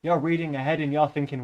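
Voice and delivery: ordinary talk, nothing marked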